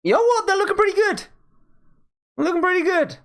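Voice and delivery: delighted with the drawing; excited. Real, not acted. Pleased with the drawing.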